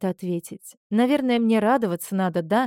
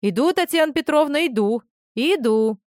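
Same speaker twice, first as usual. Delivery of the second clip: high, loud call to the kitchen; 6 semitones higher, 5 dB louder than usual. Acted. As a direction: shout it briskly to another room. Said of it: "прокричал деловито в сторону кухни".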